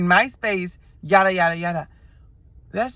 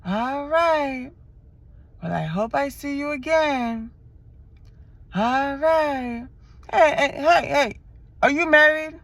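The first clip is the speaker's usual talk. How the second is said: higher hopeful man's voice; lingering suitor; 6 semitones higher, slower than usual. Retold or imitated. Driver calling after her as she leaves, higher hopeful calling. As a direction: call her back, not ready to let go. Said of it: "He over there staring".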